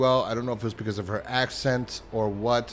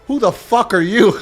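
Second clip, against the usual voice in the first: high, loud, shocked shout; baffled outburst; 10 semitones higher, 13 dB louder than usual. Retold or imitated. Barry shouting at the stranger, loud shocked disbelief. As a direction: blurt it out in shock. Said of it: "and Barry goes".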